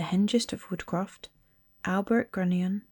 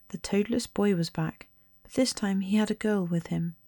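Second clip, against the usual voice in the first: usual speaking voice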